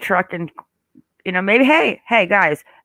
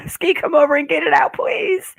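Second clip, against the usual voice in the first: high, breathy frightened plea; pleading; 10 semitones higher than usual. Real, not acted. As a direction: beg for rescue from the spider. Begging for help with spider.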